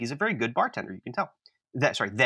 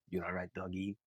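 imitated bartender, low and much softer; hushed check-in; 6 semitones lower, 13 dB quieter than usual. Bartender checking on a rattled customer, in a hushed low voice. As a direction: drop down into a confidential undertone.

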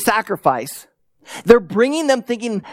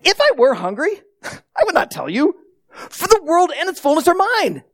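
higher-pitched, incredulous mock question; gently mocking; 8 semitones higher than usual. God telling worshippers He needs no food, lightly mocking. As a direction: tease them, lilting up high with a little laugh. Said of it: "God begins to mock them".

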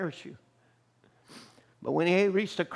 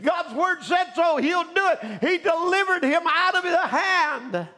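loud exhortation climbing higher to a shout; rousing preaching; 4 semitones higher, 4 dB louder than usual. Real, not acted. Preacher challenging spineless weaklings to trust God, building to a high shout. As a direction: build in pitch and force as you press the promise home.

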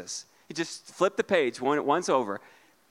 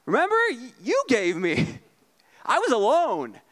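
much higher, pleading whine; mock Adam whine; 6 semitones higher than usual. Pastor voicing Adam reminding God, high pleading whine. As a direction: needle God like a sulky kid. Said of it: "He's like".

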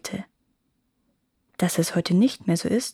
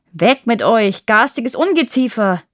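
furious yell, much louder; angry shout; 2 semitones higher, 7 dB louder than usual. A narrator's reading. Groom driving the fireflies away, shouting angrily. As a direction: chase the pests off, shouting. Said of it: "schrie er".

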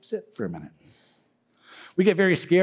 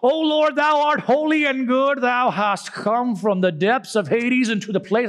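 much higher, slow, grand King James prayer voice; Shakespearean prayer; 10 semitones higher, 3 dB louder, slower than usual. Retold or imitated. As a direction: intone it like an old-fashioned preacher at a Bible study. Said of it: "he starts with something like this".

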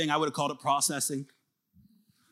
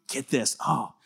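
ordinary talk

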